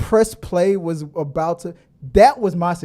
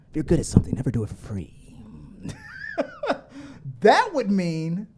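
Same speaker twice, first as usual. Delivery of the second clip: usual speaking voice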